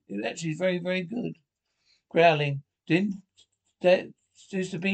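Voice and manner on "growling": loud creature growl. Acted. Creature growling at the heroes, loud growl. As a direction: growl like a cornered beast.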